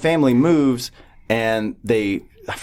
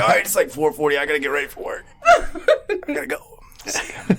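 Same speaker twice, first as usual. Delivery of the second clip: laughing goodbye; 6 semitones higher than usual. Retold or imitated. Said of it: "I was like".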